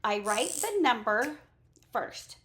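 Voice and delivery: normal talking voice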